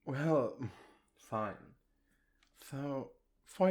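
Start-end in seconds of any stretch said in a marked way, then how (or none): 1.2-1.7 sighing give-in. Acted. Giving in with a sigh.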